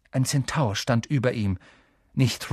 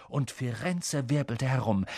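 ordinary delivery, nothing marked

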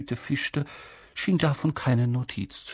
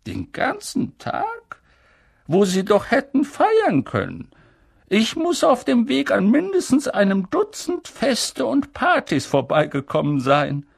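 Dumbledore voice; 11 semitones higher, 4 dB louder than usual. Acted. Dumbledore gently mocking.

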